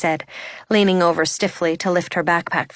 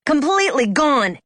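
higher-pitched angry echo; angry shout; 6 semitones higher than usual. A narrator's reading. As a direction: throw his words back, half-shouted. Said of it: "I shouted at him".

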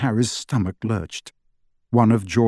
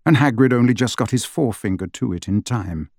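ordinary delivery

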